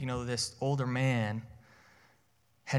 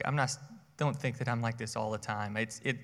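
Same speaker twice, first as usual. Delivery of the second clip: normal talking voice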